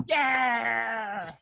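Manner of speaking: high, drawn-out celebratory yell; excited cheer. Real, not acted. Cheering another player's twenty, excited cheer. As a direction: let out a long whoop of victory.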